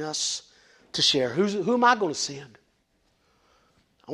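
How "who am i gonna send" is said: normal talking voice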